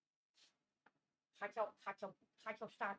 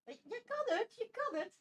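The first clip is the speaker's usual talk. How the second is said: encouraging the dog; 8 semitones higher than usual. Real, not acted.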